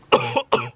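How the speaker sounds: short cough. Real, not acted. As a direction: cough once. Sick, throat hurting.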